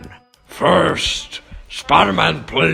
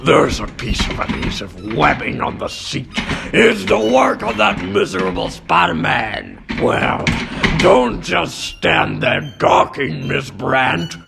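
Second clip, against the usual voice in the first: blustering, indignant complaint; irate newspaper chief giving orders; bad-tempered boss. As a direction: rant at your secretary in exasperation. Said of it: "He's in such a bad mood".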